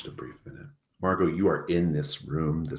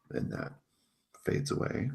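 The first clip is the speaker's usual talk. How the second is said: usual speaking voice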